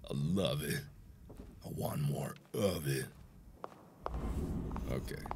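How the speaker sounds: low, soft whisper. Real, not acted. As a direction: breathe it out in a whisper. Savouring the scare.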